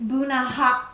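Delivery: ordinary delivery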